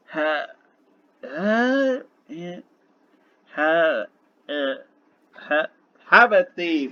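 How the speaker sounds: halting syllable-by-syllable sounding out; struggling to read. Acted. Dragon reads from a small booklet, haltingly. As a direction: stumble over the first word, then burst it out. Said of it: "he's struggling to read it".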